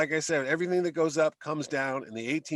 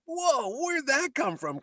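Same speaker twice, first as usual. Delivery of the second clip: surprised; 10 semitones higher, slower than usual. Retold or imitated. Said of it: "and it's like".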